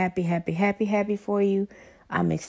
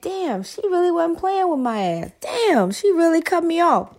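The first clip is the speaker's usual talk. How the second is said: higher-pitched mock-stunned quote; mock dismay; 8 semitones higher than usual. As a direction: imitate men realizing they got dumped. Acting out stunned left-behind men.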